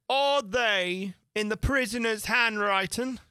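high, slow piping question; second juryman. A narrator's reading. Another juryman asks about the verses, high piping voice. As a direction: stretch it out in a creature voice.

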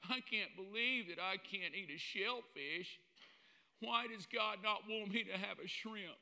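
hammed-up whining believer; whiny complainer. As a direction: pout like a spoiled kid denied bacon.

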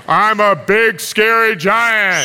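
lightly voiced pretend giant, slow; big scary giant. Preacher replaying the scare for children, slow playful giant. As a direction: drag out a mock menace. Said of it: "I'm gonna be the big, scary giants".

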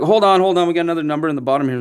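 high, loud and fast "hold on, hold on"; urgent announcer. Retold or imitated. Organizer stopping the crowd, urgent and fast. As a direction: rush it out as if just spotting a number. Organizers find one more number.

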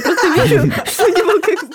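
говорит сквозь смех; прорывается смех; laughing. Real, not acted. Смех посреди рассказа. (смеётся на ходу)

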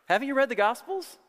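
hammed-up pestering questioner; incredulous questioner. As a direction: badger with mock disbelief, rising to a high, incredulous finish. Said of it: "you're probably asking yourself".

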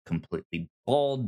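usual speaking voice

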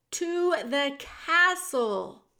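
shouted. A narrator's reading. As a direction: spell it out loudly for deaf ears. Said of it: "shouted the soldier".

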